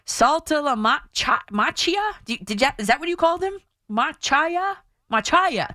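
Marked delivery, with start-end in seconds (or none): 0.1-2.1 "Saltalamacchia" very slow, high, groping for "ma-CHEE-a"; mock mispronunciation. Shown as a demonstration. Host challenging critics' reading of the name, puzzled wrong pronunciation. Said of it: "That's what it looks like". 3.9-5.7 "Machia" as "ma-CHAI-ya", high question then falling answer; mock mispronunciation. Shown as a demonstration. Host trying out the name as it looks, deliberately wrong pronunciation. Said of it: "That's what it looks like".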